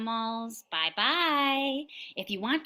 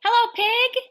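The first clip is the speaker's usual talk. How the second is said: high, loud greeting to pig; reading to a toddler; 13 semitones higher, 7 dB louder than usual. Acted. Reading to little kids.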